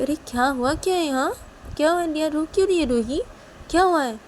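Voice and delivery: high, urgent father's questions; alarmed questioning. Acted. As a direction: press them, worried. Father alarmed by crying child.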